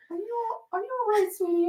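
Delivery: high, gentle caring voice; kindly stranger. Retold or imitated. A stranger checking on the crying writer, high and gentle. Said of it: "this guy was like".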